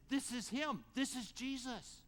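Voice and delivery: high, eager exclaim; excited recognition. Acted. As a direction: point him out, thrilled it is him. Disciple recognizing Jesus on shore.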